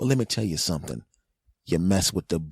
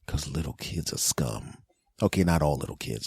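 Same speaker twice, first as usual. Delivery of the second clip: ordinary talk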